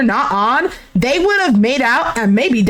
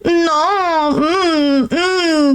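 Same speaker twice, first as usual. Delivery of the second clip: cutesy humming refusal; mock-cute drawn-out whine; cute, mouth full; 3 semitones higher, much slower than usual. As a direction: imitate a coy hum through closed lips. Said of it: "She's acting all cute while having water in her mouth".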